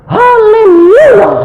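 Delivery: very high, loud, stretched-out shriek; acted ecstatic howl; crazed praise. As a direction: scream praise as if gone mad, imitating her. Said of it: "Jadi kayak orang gila".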